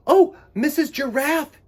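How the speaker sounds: put-on high, loud child-bird cry; little bird crying out. Choco calling to Missus Giraffe, high and loud. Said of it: "he cried".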